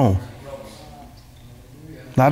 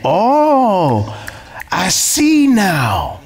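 drawn-out slow "oh, I see now" pitched higher; mock realization; 6 semitones higher, much slower than usual. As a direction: act out the dawning of understanding. Preacher acts out realization.

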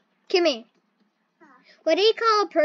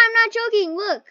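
usual speaking voice

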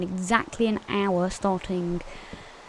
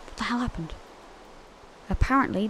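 usual speaking voice

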